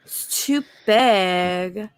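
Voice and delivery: slow suggestive. Pretend. Innuendo joke, slow drawn.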